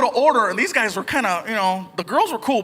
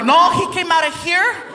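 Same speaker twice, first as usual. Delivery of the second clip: mock mom; 8 semitones higher, 3 dB louder than usual. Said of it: "she tells everybody".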